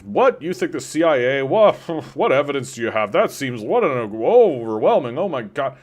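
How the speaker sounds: high, loud mock astonishment with wildly swooping pitch; mock surprise. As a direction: act hammed-up dimwit disbelief, overwhelmed by it all. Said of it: "acting like dimwits or that they're surprised".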